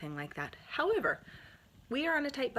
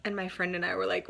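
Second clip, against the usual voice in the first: usual speaking voice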